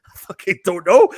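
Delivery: loud, exasperated retold reply; shouting the answer back at dad in frustration; exasperated outburst. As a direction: blurt it out, fed up and loud, high.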